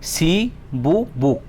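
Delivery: "Shibu buk" very slow, as shi, bu, buk with gaps; syllable by syllable. Shown as a demonstration. Teacher showing a word's sound parts, "Shibu buk" in three slow pieces. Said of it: "khorang part part part".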